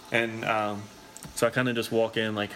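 usual speaking voice

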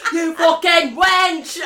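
slow, drawn-out loud insult; high, loud, exaggerated abuse; mock Viking yell. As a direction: bellow it like a hammy Viking. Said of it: "Just yell everything that he says".

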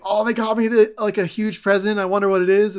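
high, loud imitated glee; mock excitement. Peter finds a huge present waiting, mock excitement. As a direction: act out giddy anticipation, hammed up.

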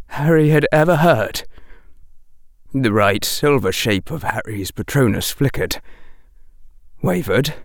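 ordinary delivery, nothing marked

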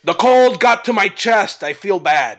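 high put-on excuse voice; posing as sick orc. Intruder excuses the wrong voice to the orc, put-on and high. Player bluffs as a sick Grob.

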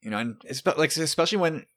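ordinary talk

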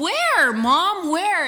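put-on a toddler's baffled search; hammed-up toddler searching his mom's face; child asking. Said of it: "he leans back and he goes".

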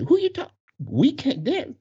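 high, incredulous comeback; acted indignation. Dancer challenging the employee's order, high incredulous indignation. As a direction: challenge him in mock outrage.

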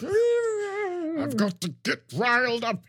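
slow, high, worked-up Picard; riled-up Picard voice. Shown as a demonstration. Host demos getting riled up as Picard. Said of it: "I really gotta get riled up".

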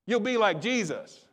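usual speaking voice

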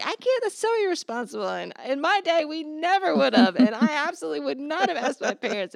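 high-pitched hammed-up parent tirade; mock scolding rant. Parent going off on procrastinating child, mock rant. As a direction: overplay the outraged parent, laughing through it. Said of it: "you just go off on this child".

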